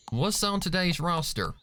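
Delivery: high, bouncy filly voice; eager filly. A narrator's reading. Apple Bloom asks her friends the plan, eagerly. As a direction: ask like you're prancing along. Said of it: "Apple Bloom asked".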